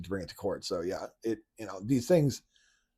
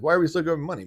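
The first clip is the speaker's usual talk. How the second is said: higher and louder incredulous ask; mock indignant question; 6 semitones higher, 5 dB louder, much faster than usual. Voicing a commenter's outrage, mock incredulous. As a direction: throw the question up in disbelief. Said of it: "He's like".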